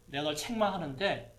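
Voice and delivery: normal talking voice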